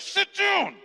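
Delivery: very high, strained order; comically high-pitched command; strained scolding. Retold or imitated. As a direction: imitate an outraged worshipper hushing someone. Annoyed worshipper snaps at delivery man.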